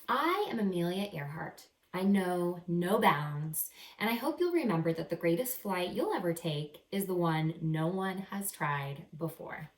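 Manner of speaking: bright, lively picture-book reading voice; animated first-person story narration; reading to children. A narrator's reading. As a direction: read it like a storybook to young kids. Reading Amelia's closing lesson aloud.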